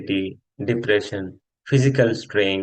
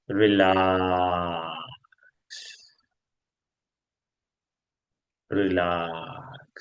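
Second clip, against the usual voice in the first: long drawn-out, lower relax; soothing relaxation cue; 4 semitones lower, 5 dB quieter, much slower than usual. Acted. Yoga teacher releasing the class after breathing, low drawn-out cue. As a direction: sink down and stretch the vowel out slowly. Ending the counted breathing round.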